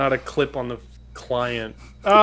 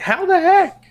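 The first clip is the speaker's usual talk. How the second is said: astonished; 6 dB louder than usual. Retold or imitated. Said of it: "And she's like".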